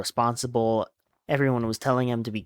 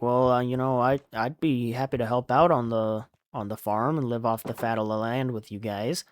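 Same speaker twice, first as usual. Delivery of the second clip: usual speaking voice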